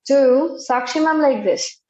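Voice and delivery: normal talking voice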